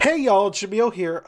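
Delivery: showy intro greeting. Acted. Jamil copying a favorite YouTuber's intro, loud and showy. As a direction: belt it out like a video intro.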